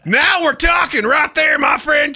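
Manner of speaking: high, loud hillbilly Southern twang; big high-pitched Southern accent. Shown as a demonstration. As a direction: ham up a Southern accent on request. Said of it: "southern, southern accent".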